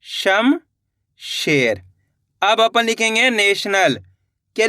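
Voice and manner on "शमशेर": शमशेर drawn out very slowly, syllables joined; slow teacher blending; slow blended word. Shown as a demonstration. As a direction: stretch शमशेर out as the letters combine. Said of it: "तो मिलके बन जाएगा अपने".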